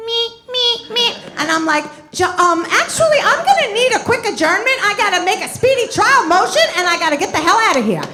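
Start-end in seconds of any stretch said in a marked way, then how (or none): 2.1-8.0 rushed. Acted. Rushing out of court.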